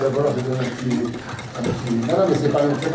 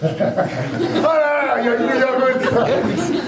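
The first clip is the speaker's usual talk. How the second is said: laughing; 9 semitones higher, slower than usual. Real, not acted. Amused at a student's huge drop.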